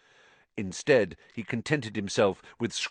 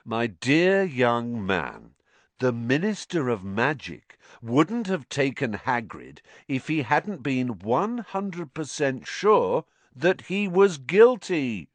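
slow, higher, condescending tone; patronizing explanation; 5 semitones higher, 3 dB louder, slower than usual. A narrator's reading. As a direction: explain the obvious, stretching the words. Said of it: "in the tone of someone explaining that one and one made two".

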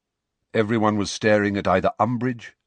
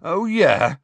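raised, loud sceptical retort; heated scorn; 10 semitones higher, 7 dB louder than usual. A narrator's reading. Student contradicting the professor, temper near boiling. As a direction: throw it back with rising temper. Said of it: "His temper, ... was reaching boiling point".

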